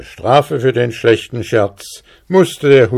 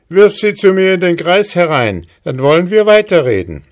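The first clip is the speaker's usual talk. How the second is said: laughing call; 9 semitones higher, 3 dB louder, faster than usual. Acted. Hussar bargains.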